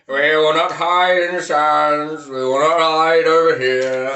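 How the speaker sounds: slow, loud, booming speech parody; mock wartime speech. Mocking a Churchill war speech, slow and loud. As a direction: ham up a rousing wartime leader addressing the nation.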